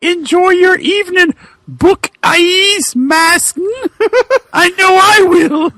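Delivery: sultry villainess. Acted. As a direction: tease him like a cartoon temptress. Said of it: "raspy with sexuality and hate".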